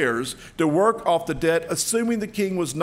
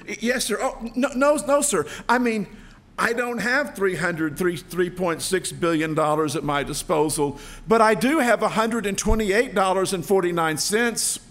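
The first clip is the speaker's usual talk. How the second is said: higher, louder stammering panic; panicky servant; 4 semitones higher, 4 dB louder than usual. Acted. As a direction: babble in fear before the king. Terrified servant stammers before king.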